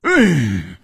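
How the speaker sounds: long, heavy sigh; big sigh of self-reproach. A narrator's reading. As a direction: heave a loud, weary sigh. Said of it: "长长的叹了一口气".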